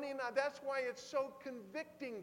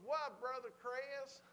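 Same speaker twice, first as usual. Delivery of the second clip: slow, higher Southern drawl; Randy Riddle; 4 semitones higher, slower than usual. Retold or imitated. Friend answering the preacher, slow Southern drawl. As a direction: answer unhurried in an imitated Southern accent. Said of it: "He goes".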